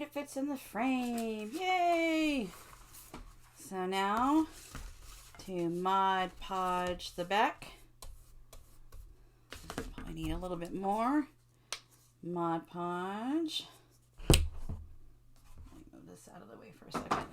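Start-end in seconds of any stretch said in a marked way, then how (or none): 1.5-2.5 high happy yay; excited cheer. Real, not acted. Frame fits, cheering. 14.3-17.3 whispering. Real, not acted. Aside while working.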